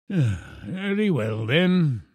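slow, drawn-out consent; relenting old professor. A narrator's reading. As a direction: give in with mock weariness. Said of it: "said the professor".

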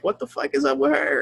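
high-pitched, mock-baffled dad; retold exasperation. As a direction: voice climbing in exaggerated disbelief. Retelling his exasperation at daughter.

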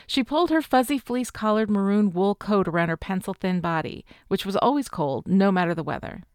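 ordinary delivery, nothing marked

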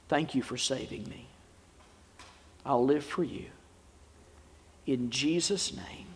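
quiet, measured prayer with long pauses; leading prayer. Real, not acted. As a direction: lead them gently through a prayer, phrase by phrase. Leads a prayer for listeners to repeat.

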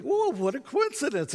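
high, mock-delighted surprise; hammed-up pleasant surprise; keeping it light. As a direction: feign breezy delight to ease tension. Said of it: "trying to keep it light".

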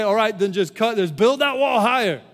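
hammed-up brush-off; mock dismissive voice. Mock quote Jesus doesn't say.

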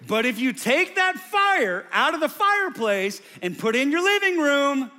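impassioned shouted declaration; full-force preaching. Real, not acted. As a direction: build to a booming climax.

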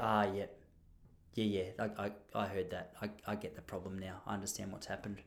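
very soft, low, to himself; sheepish realisation. Retold or imitated. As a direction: let it sink in, voice dropping away. Acting out the sinking realisation.

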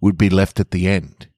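gentle storyteller reading; measured story reading voice; story narration. A narrator's reading. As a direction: read it like a bedtime tale to listeners. Reading a short story aloud.

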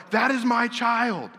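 high, slow insistent woman's voice; claiming woman. Retold or imitated. Woman claiming the baby before King Solomon, high slow insistence. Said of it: "the one who doesn't have the child's like".

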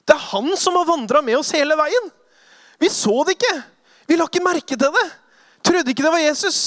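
high, loud, rushing amazement; thrilled realization. Acted. Disciples realizing it was Jesus, high excited voice. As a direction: it dawns on them all at once.

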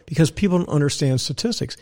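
ordinary talk, nothing marked

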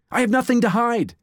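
ordinary talk

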